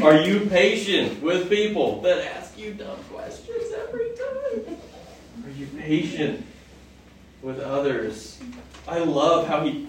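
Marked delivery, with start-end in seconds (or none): 0.0-8.4 teasing the congregation with a grin; playful laughing question; laughing challenge. Real, not acted.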